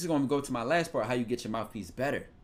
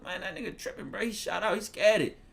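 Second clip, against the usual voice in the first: higher Philly street voice; Philly talk; 9 semitones higher than usual. Retold or imitated. Philly guy siding with his friend, higher pitched. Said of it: "I'm from Philly. This is how we talk".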